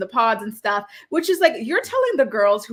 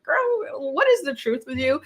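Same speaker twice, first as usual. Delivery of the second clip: put-on sassy girl; 5 semitones higher than usual. Recapper sassing Uche about his life, sassy. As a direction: tease him with a sky-high girl. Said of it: "It's like".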